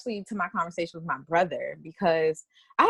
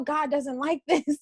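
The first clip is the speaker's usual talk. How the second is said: mocking family; 7 semitones higher, slower than usual. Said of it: "that was all I was hearing".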